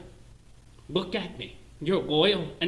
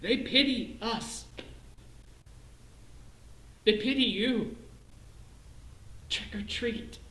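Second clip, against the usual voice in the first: normal talking voice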